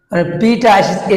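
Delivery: normal talking voice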